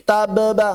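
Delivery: "Tabba" with an extra released be before ba; wrongly released b. Shown as a demonstration. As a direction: let the b of "Tabba" bounce open, ta-be-ba. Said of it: "Tapi jangan dilepas".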